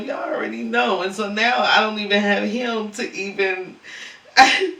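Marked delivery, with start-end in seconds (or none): 4.4-4.7 a sudden high, loud pained laugh. Real, not acted. Mourning her lost ferret on camera, a pained laugh. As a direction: laugh through real grief. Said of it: "I can't even cry".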